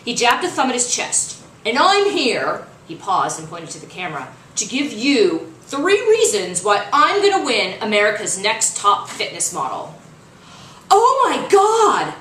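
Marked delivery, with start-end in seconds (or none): 1.6-2.7 slow, loud, higher boast; milk the pause like a showman; showboating coach. Acted. 4.6-10.0 loud, boastful audition pitch slowing at the end; cocky pitch. Acted. Coach's audition video pitch. 10.9-12.1 slow, much higher, furious roar. Acted. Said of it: "Coach Creed's roar".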